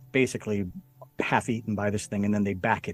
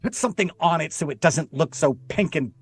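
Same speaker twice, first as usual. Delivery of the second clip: raised-pitch exasperated mock yell; agitated, higher and a touch louder, acted out; retold screamed order; 5 semitones higher, 4 dB louder than usual. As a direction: imitate a fed-up woman ordering the crew. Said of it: "screaming, and she said".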